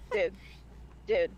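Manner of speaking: normal talking voice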